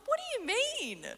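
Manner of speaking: high, squeaky incredulous question; mock disbelief. Asking the pastor what he means, a squeaky disbelief. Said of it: "I'm like".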